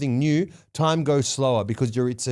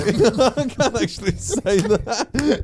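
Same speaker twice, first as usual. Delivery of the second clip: slow, higher, laughing through the words; 6 semitones higher, much slower than usual. Real, not acted. Host dodging the least favorite accent question, laughing. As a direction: try to speak through giggles.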